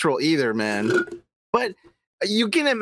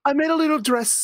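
higher, quick mock British voice; mock British accent; 7 semitones higher, much faster than usual. Co-host mimicking the singer's dress video, mock British. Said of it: "do a fucking British accent".

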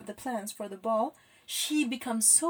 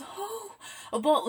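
shaky, breathless excitement; trembling voice; 4 semitones higher, slower than usual. Retold or imitated. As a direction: quiver the words with excitement. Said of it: "in a really trembling voice".

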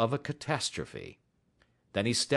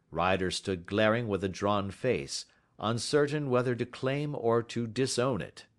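plain reading voice

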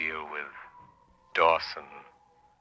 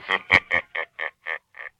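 eerie laugh; 4 dB louder than usual. Acted. The Shadow's laugh with music.

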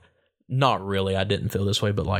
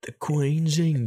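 higher, slow put-on Queen's English accent; mock English accent; 5 semitones higher, slower than usual. Mocking the Queen's English just mentioned.

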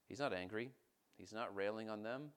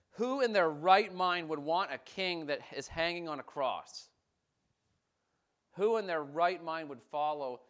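loud, high, impassioned preaching; fervent pulpit appeal; 4 semitones higher, 9 dB louder than usual. Real, not acted. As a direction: drive the question home to the congregation. Preaches at full force.

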